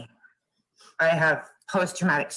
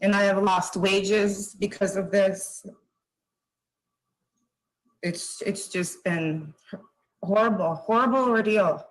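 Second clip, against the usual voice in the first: choked up; 2 semitones higher than usual. Real, not acted. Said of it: "She's triggered".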